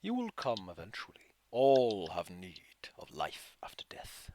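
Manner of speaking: low slow ominous whisper; eerie whisper. Acted. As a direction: promise death in a whisper. DM voicing dark voice.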